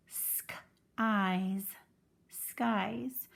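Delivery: whispered "sk" then "eyes" then blended "skies"; blending sounds. Shown as a demonstration. Teacher blends skies sound by sound for the class. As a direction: build skies from sk plus eyes, slowly. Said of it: "the first vowel does the talking".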